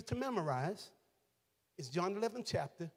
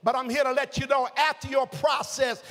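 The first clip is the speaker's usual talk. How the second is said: loud, high, building sermon climax; full-force gospel preaching, louder and higher; 8 semitones higher, 8 dB louder than usual. Acted. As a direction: drive it home to the congregation.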